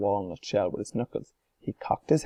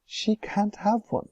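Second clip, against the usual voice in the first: lightly voiced Wonka; 11 semitones higher, slower than usual. A narrator's reading. Wonka refuses calmly.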